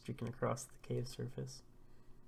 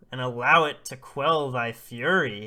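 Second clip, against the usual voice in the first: loud, ceremonial declaiming; fervent invocation; 13 dB louder than usual. Acted. Oba offers the infant to the sun, loud fervent invocation. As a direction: proclaim it as the climax of a ritual.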